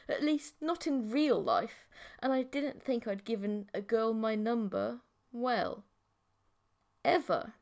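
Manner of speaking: ordinary delivery, nothing marked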